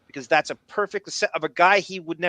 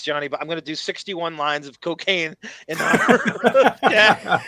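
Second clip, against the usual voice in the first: laughing quote; 2 semitones higher, 6 dB louder than usual. Retold or imitated. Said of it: "That's classic Johnny Bye Bye".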